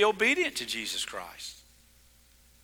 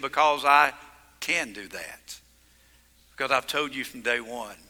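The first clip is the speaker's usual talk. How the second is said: much softer, calm and assured; with authority and love; 8 semitones lower, 6 dB quieter than usual. Acted. Jesus answering his accusers, soft and compassionate. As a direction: say it gently, with quiet authority. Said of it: "He said it with authority and love and compassion".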